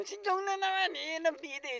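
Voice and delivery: hyped intro. Acted. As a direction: hype up the hero with a high call. Hyping the hero Jong. (masiglang pagpapakilala sa bida)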